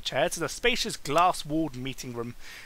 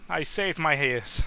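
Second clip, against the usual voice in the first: ordinary delivery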